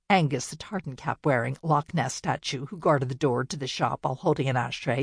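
ordinary delivery

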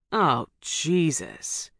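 slow exasperated groan. A narrator's reading. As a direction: drag it out, fed up. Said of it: "Jason groaned".

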